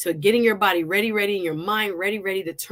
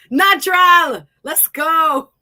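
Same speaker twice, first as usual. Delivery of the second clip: excited cheer; 6 semitones higher, 9 dB louder, slower than usual. Real, not acted. Cheering the wig coming off.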